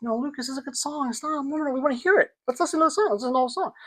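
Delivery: father coaxing his son to hear the song, high and gentle; coaxing parent. Retold or imitated. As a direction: sweet-talk a child into listening. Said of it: "And I was like".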